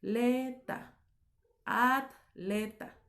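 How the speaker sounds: "atleta" said slowly as at... le... ta; split between syllables. Shown as a demonstration. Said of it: "en distintas sílabas".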